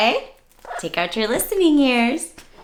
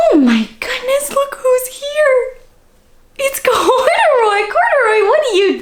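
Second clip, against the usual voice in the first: excited gasp of delight; 13 semitones higher, 4 dB louder than usual. Acted. Teacher spotting Corduroy for the kids, high and thrilled. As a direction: greet the bear like a thrilling surprise.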